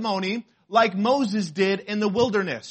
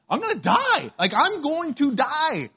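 high-pitched, hammed-up dread; mock panic; 8 semitones higher than usual. Pastor fearing he is allergic to stings, mock panic. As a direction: exaggerate the panic, slowing down on the repeat. Acts his fear of being allergic.

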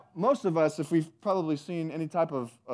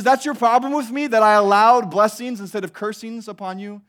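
indignant God; 5 semitones higher, 8 dB louder than usual. Acted. God voiced rebuking Israel.

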